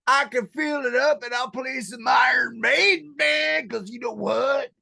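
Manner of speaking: loud hillbilly bragging. Acted. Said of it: "do the podcast in that voice".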